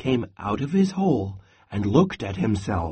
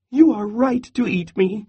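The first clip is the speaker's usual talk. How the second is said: high small squirrel voice; pleading squirrel; 9 semitones higher, 3 dB louder than usual. A narrator's reading. Pinned squirrel humours the hungry Bear, pleading.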